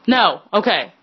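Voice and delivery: ordinary talk, nothing marked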